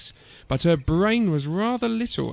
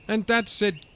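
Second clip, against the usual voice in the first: ordinary delivery, nothing marked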